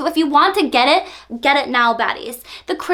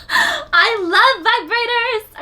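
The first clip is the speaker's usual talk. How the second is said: high, slow, near-crying gush; 9 semitones higher, slower than usual. Real, not acted. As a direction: gush it out, overcome. Gushing laughing exclamation.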